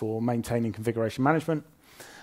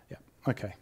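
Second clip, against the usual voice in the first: whispered aside to self; whispering; 2 semitones lower, 7 dB quieter, much faster than usual. Real, not acted. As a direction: breathe it under your breath. Muttering to self during demo.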